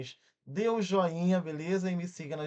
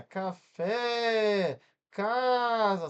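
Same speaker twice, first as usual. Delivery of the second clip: café and casa called out with the stressed vowel held long, higher and louder; schoolroom stretched syllable; 6 semitones higher, 6 dB louder, much slower than usual. Retold or imitated. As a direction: draw out the FÉ of café and the CA of casa loudly. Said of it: "como é que a gente aprendia?". (sílaba tônica esticada em "café" e "casa")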